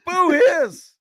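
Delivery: high, loud mock boos and hisses at a villain; heckler booing. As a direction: boo like an old melodrama audience. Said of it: "go to be a heckler".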